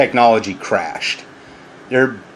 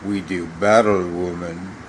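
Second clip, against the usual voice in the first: mock epic challenge; 6 semitones lower, 6 dB quieter than usual.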